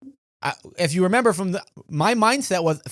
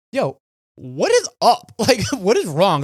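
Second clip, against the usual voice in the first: slow, louder, higher call across lanes; slow and loud, half laughing; shouting across to the angry driver; calling out the window; 3 semitones higher, 5 dB louder, slower than usual. Retold or imitated. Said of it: "I'm like".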